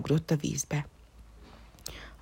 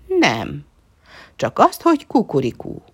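louder, proud rooster reply; rooster answering; 7 dB louder than usual. A narrator's reading. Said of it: "válaszolta a kakas".

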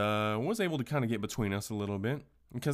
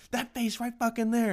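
high-pitched mocking Karen voice, pointing at him; angry customer mocking the cashier's face, in a shrill voice; 13 semitones higher, 3 dB louder than usual. Hammed-up shrill Karen shout.

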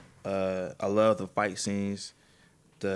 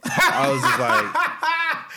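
high, slow, laughing through it; helpless laughter while retelling; 7 dB louder, much slower than usual. Real, not acted. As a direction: crack up mid-sentence. Cracking up at scene.

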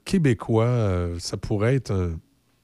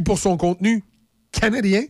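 ordinary talk

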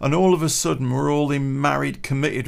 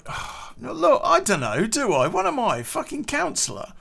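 high-pitched, defensive backpedalling; half-laughing, mock-innocent protest; 10 semitones higher than usual. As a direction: throw your hands up, pitched up high. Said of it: "And I was like".

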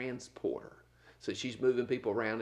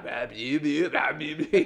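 mock stutter; 2 semitones higher, 8 dB louder, much slower than usual. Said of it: "he's stuttering all that stuff".

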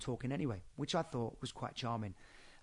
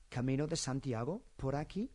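phrase climbs to a rise on "aquí"; rising question tune. Shown as a demonstration. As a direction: lift "aquí" at the end to ask. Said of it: "an inflection at the end".

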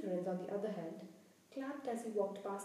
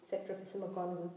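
usual speaking voice

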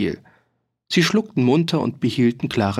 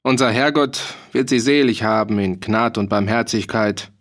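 usual speaking voice

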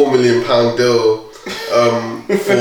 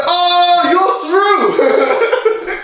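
very high, excited mock cry; imitated DJ verdict; much slower than usual. DJ putting a girl through, imitated excited call. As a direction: announce a winner with laughing delight. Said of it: "He's like".

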